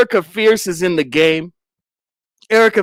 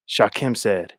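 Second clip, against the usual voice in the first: ordinary talk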